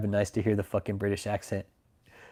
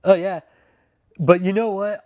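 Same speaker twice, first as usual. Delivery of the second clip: much higher and louder, eager; excited; 9 semitones higher, 8 dB louder than usual. Real, not acted. Streamer excitedly floats a theory to chat. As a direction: burst in with a sudden idea.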